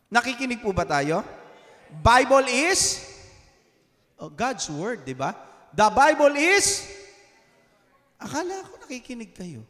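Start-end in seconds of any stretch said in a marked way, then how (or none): 2.0-3.0 slow, rising call left hanging; slow preacher's prompt for the crowd. Shown as a demonstration. Preacher prompts congregation to finish. 5.8-6.9 slow and louder, rising on is and left open; call-and-response prompt from the pulpit. Shown as a demonstration. Preacher prompts congregation again.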